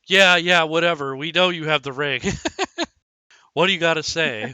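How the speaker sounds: wedding guests to Balin, dismissive and loud; dismissive crowd. Acted. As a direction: brush him off, bored guests.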